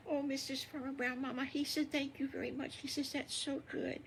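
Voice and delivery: a touch of tearful thanks; mild crying. Acted. Wolf thanking Missus Farmer Brown, lightly teary and high. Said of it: "And he starts crying".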